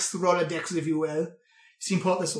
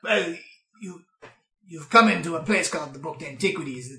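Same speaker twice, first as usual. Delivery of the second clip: normal talking voice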